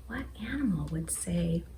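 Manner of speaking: fast hushed whisper. Real, not acted. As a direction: lean in and whisper the question. Teacher builds suspense for kids.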